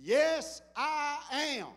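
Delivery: high, emphatic answer, each word separated; firm declaration. Acted. Student answering the gunman's question, firm declaration. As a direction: stand your ground word by word.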